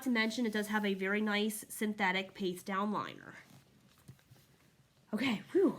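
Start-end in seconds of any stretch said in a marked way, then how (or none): 5.1-5.8 breathless relief after exertion; out of breath. Real, not acted. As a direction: puff it out, winded from holding it up. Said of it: "I'm, like, out of breath".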